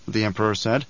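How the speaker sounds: usual speaking voice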